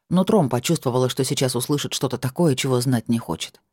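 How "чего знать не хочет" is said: ordinary delivery, nothing marked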